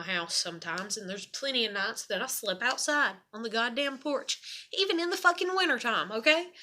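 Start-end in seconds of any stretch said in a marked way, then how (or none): 4.7-6.5 higher and faster, venting; exasperated and rushed, pitch jumping up; indignant outburst. Real, not acted. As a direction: fire it off in outrage. Angry rant about sleeping outside.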